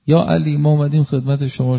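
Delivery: loud plea to his master; pleading cry. Retold or imitated. Student pleads with Imam Ali.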